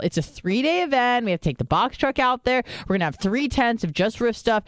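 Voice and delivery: high-pitched exasperated mock lecture; indignant schooling. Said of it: "I'm, like, schooling him".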